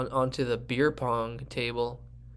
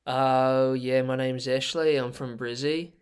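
put-on laid-back Australian snowboarder accent; slower than usual. Snowboarder introducing themself to podcast listeners, Australian accent. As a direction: put on an easygoing Aussie accent. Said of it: "I would like you to do your Australian".

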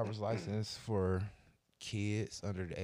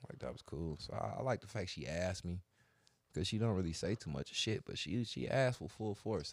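ordinary delivery

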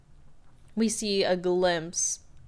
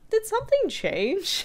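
high squeaky voice. Retold or imitated.

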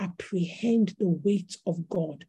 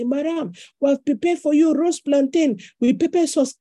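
imitating the chef; 6 semitones higher, 5 dB louder than usual. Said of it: "chef announcing food".

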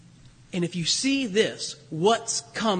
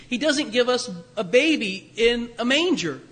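ordinary talk

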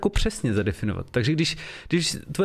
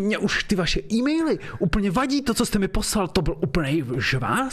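higher, hammed-up angry outburst; mock rage; 6 semitones higher than usual. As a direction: quit in a huff, playfully. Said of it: "pojďme si to zahrát".

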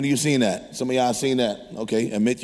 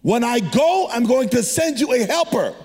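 preaching; 7 semitones higher than usual. Acted. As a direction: preach it with rising fire. Long preached passage.

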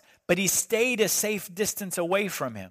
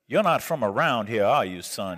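put-on Mississippi accent; 7 semitones lower than usual. Customer putting on a local act for the clerk, Mississippi accent. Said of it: "doing the Mississippi accent".

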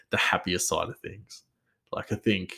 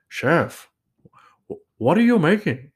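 higher-pitched imitated Nepali accent; 8 semitones higher, 5 dB louder than usual. Nepali chef asks colleague what he's making, imitated Nepali accent. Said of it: "either the accent or the voice".

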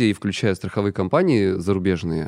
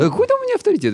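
incredulous; 4 dB louder, much faster than usual. Real, not acted. Modest disbelief at praise.

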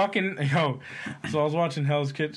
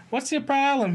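mock mom; 12 semitones higher than usual. Mom confronting her son in the kitchen, high scold. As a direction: play the mom barging in, high. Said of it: "his mom came in".

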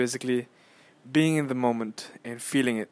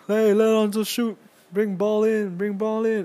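louder, much higher call to the bench; fans shouting; 11 semitones higher, 7 dB louder than usual. Retold or imitated. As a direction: yell it from the upper deck.

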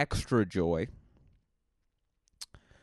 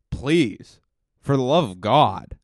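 emphatic plea; 7 dB louder, slower than usual. Real, not acted. Begging fans, louder.

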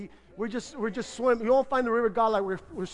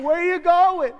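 called-out question; 7 semitones higher, 7 dB louder than usual. Retold or imitated. Calling to crawling girl.

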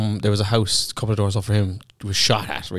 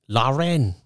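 higher, drawn-out north side Lauren; put-on north side accent; 5 semitones higher than usual. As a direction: say the name like a north sider. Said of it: "Any name in a north side accent".

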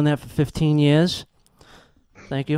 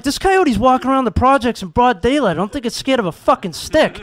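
high-pitched, exasperated arguing; incredulous protest; 7 semitones higher, 4 dB louder than usual. Acted. Janitor argues with police officer, incredulous. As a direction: push back in mock disbelief.

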